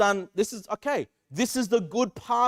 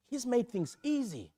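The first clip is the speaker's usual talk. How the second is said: usual speaking voice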